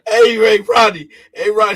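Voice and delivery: loud, high call across the room. Real, not acted. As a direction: shout for Rodney's attention. Calling out to friend Rodney.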